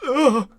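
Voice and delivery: acted "ugh" from a hard fall; imitated pained grunt. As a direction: grunt as if slammed to the ground, mimicking. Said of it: "and he goes".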